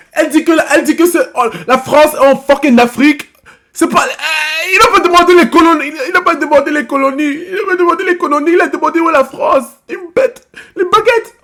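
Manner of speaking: loud, high, outraged yelling; indignant rant. Real, not acted. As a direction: shout at the absurdity. Indignant rant about map answer.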